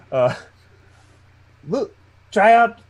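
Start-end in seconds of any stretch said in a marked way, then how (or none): 1.7-2.8 acted yell. Player character yelling instructions to the others, high calling voice. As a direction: call out as if across the water. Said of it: "And then he yells".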